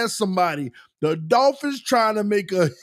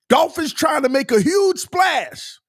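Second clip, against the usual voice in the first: reading the title aloud; 9 semitones higher than usual. Real, not acted. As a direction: shout the headline through a laugh. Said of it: "And it says".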